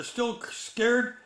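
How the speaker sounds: plain reading voice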